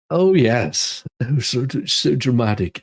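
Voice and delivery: ordinary talk, nothing marked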